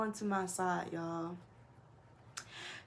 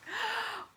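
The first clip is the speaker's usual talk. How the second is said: sharp high gasp; shocked gasp; 14 semitones higher, 4 dB quieter than usual. Retold or imitated. Reacting to the roommate asking to fight, gasping.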